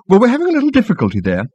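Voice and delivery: high, quick excuse; defensive girl. A narrator's reading. Marketing girl answers captain, defensively. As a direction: explain it away quickly.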